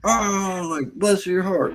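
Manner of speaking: high, drawn-out fake sweetness; slow mock Southern condescension; passive-aggressive Southern. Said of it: "like a southern".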